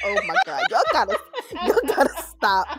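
laughing through a mock plea; laughing protest. Begging friends to stop posting, laughing. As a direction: giggle while begging them to stop. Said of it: "I said".

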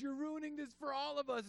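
high-pitched scolding wife; wife's high scold. Retold or imitated. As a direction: nag him in her high voice. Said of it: "she goes".

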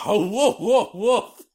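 high silly barking; dog barks. Retold or imitated. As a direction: yip like a cartoon dog.

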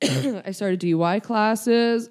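ordinary talk

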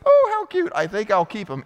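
put-on sudden high, loud gushing over a baby; mock princess. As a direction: coo like a spoiled daughter of a king. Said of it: "finds the baby and goes".

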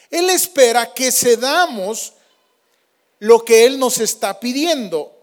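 sermon at full force, rising and loud; predicación enérgica. Acted. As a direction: preach it out with fire. Predica con fuerza. (predicando con fuerza)

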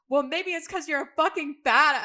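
mock yelled insult. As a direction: hurl the insult in a hammed-up shout. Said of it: "And she's like".

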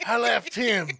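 put-on loud angry drunk rant; drunk brother yelling. Drunk brother yelling at the street, loud and angry. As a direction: yell it like a wasted brother picking fights. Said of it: "He was like".

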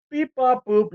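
quick beep bop boop button tones; phone dialing beeps. Shown as a demonstration. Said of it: "No, it would be the".

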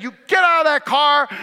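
loud commanding mom shout; stern shout. Retold or imitated. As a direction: order her out of the car. Mom ordering daughter out.

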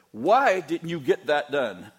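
loud, slow angry demand; yelled version. Shown as a demonstration. Pastor showing how his mild question lands on staff as a shout. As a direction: bark it like a furious boss. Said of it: "It sounds like I said".

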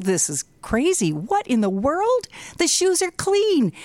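incredulous, rising to high pitch at the end; can't believe what you're seeing; disbelief. Retold or imitated. Husband, watching shoes get scrubbed, incredulous.

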